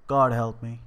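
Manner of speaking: loud, understated cry of despair; a contained outburst; anguished cry. A narrator's reading. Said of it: "he cried".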